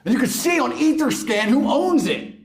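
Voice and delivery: high whiny crypto bro; mocking crypto bro. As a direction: parody an eager NFT fan. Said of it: "people were saying to me".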